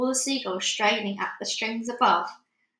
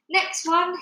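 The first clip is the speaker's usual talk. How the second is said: shouted; 8 semitones higher, 4 dB louder than usual. A narrator's reading. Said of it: "he shouted".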